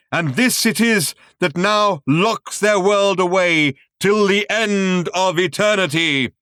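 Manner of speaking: booming, high grand showman voice; grand announcer. A narrator's reading. Film narrator booms at the audience, grand and loud. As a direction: proclaim it like a cosmic showman.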